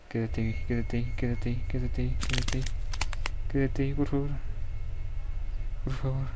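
anxious, insistent begging; nervous pleading. Real, not acted. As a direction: beg it to stay where it is. Begging the monster to stay put.

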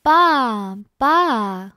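loud, drawn-out storybook baa; bleating sheep. A narrator's reading. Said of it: "bleated the sheep".

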